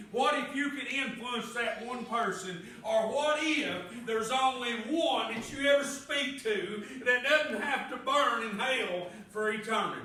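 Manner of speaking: loud, ringing preacher voice, pressing each line home; full-force preaching. Acted. Preacher testifying God's control to church, loud preaching. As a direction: preach it out, building to a shout.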